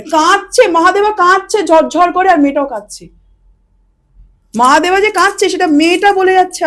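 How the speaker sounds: telling it with rising excitement; excited telling. Real, not acted. Telling a friend how the father wept, worked up.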